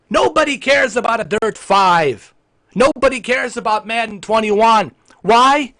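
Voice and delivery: high, loud, emphatic rant; angry shouting. Acted. Streamer losing his temper over third-party games, angry shouting. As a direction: shout each complaint, rising to the question. Loses temper after promising niceness.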